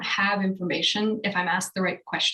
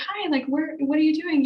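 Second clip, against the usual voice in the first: put-on higher, sing-song greeting to a small child; talking to a toddler; 8 semitones higher, 3 dB louder than usual. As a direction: greet a little girl kindly.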